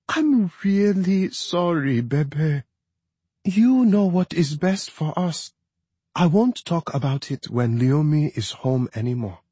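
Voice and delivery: slow, gentle sorry wife; apologetic. A narrator's reading.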